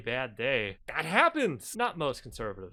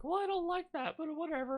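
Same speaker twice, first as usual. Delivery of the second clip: Jordan Peterson impression; faster than usual. Streamer imagining the pundit shrugging it off, high mock voice. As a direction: put on a high caricature of a grumbler. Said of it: "Jordan Peterson was just like".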